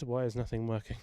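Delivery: normal talking voice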